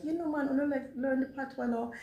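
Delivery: high-pitched playful teasing; mock teasing, pitched well up. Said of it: "sometimes I tease them like".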